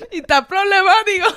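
loud, pleading and laughing through it; urging him to drop it, half laughing; hushing a friend. Retold or imitated. Telling drunk Carlos loudly to calm down, laughing. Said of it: "yo estaba al frente de Carlos así".

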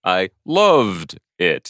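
LOVED stretched slow and long; drawn-out gushing. Acted. Podcaster playing a fan of True Detective, stretched LOVED. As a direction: lean hard on "loved", drawing it out.

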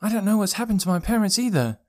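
put-on high, small, upset boy's voice; boy holding back tears; near tears. A narrator's reading. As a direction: say it close to crying, pitched high. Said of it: "in danger of crying".

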